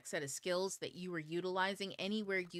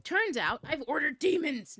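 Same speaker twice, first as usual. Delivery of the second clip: mock alarm; 8 semitones higher, 9 dB louder than usual. Comic punchline before just kidding.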